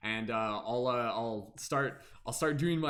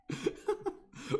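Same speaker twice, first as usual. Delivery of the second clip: breathy laugh. Real, not acted. Amused by wrong guesses.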